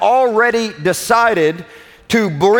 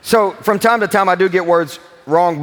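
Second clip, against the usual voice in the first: usual speaking voice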